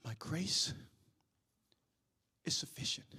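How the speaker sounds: breathy low whisper of God's answer. Acted. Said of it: "I heard the voice of the Lord respond".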